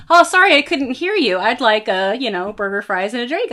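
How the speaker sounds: high, loud, flustered order; imitating a loud customer; loud apologetic customer. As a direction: shout it apologetically over the window. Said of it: "they turn their truck off and they go".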